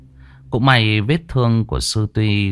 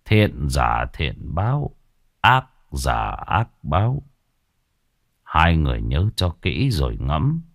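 put-on solemn old monk; 5 semitones lower than usual. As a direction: deliver it like a grave warning. Monk's parting words, solemn.

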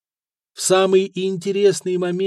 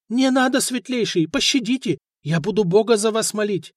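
high, desperate pleading; frightened plea; 7 semitones higher than usual. A narrator's reading. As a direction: plead for your life, pitched up. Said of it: "начал извиваться ростовщик".

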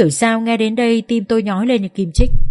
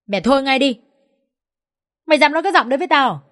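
furious rebuke; 6 semitones higher, faster than usual. Acted. Father scolds furious mother-in-law.

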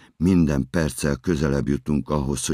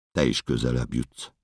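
usual speaking voice

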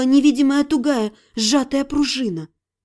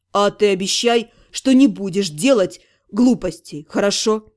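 lower, steady reassurance; reassuring promise; 6 semitones lower than usual. Acted. Ирга promises to protect her family, lower and reassuring. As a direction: promise and ask for hers.

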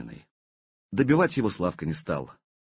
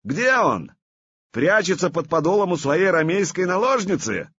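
mocking taunt; 8 semitones higher, 3 dB louder than usual. Vladimir mocks the absent brother.

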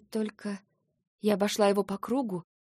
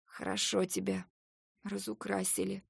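hoarse; 5 dB quieter than usual. Acted. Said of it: "сипло спросила я".

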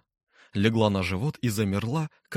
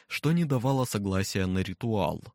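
usual speaking voice

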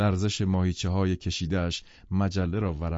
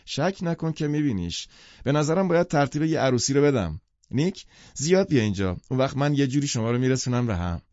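higher, eager, pushing each promise; emphatic teasing; 6 semitones higher than usual. Acted. Daisy teasing Nick about setting him up, emphatic and higher. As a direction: rattle off matchmaking plans with relish. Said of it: "دیزی با تأکید گفت".

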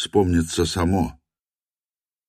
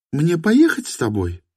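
«Мне поехать с тобой?» in her higher voice; woman's voice; 11 semitones higher than usual. A narrator's reading. («Мне поехать с тобой?» женским голосом)

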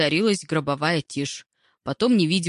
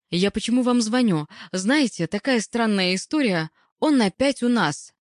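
higher, lively phone voice; lightly voiced hospital caller; anxious female caller; 5 semitones higher than usual. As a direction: share strange news with some urgency. Caller breaks strange news.

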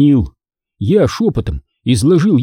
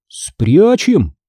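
usual speaking voice

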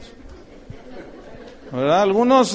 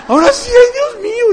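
loud, high hammed-up wail of fear; panicked passengers' wail; 8 dB louder than usual. Preacher acting out frightened passengers on the plane, loud high wail. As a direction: shriek it like a passenger sure the plane will crash. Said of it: "y empezó allí la".

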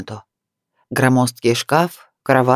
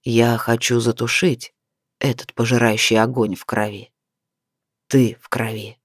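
seething desire; 5 semitones lower than usual. A narrator's reading. Man tells the woman of his burning need, low and seething. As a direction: keep it deep and held in, word by word. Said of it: "процедил он в лицо".

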